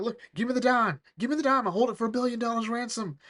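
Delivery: high, insistent demand; demanding villain. Acted. Acts Blackheart demanding the dime.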